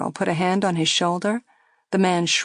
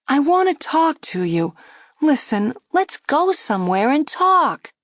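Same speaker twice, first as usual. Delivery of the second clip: higher, louder coaxing plea; girl coaxing; 8 semitones higher, 4 dB louder than usual. A narrator's reading. Girl coaxing Joey to skip another drink. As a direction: gently press him to stop. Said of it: "the girl said".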